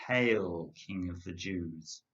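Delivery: ordinary talk, nothing marked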